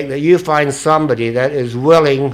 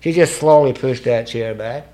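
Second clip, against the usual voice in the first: usual speaking voice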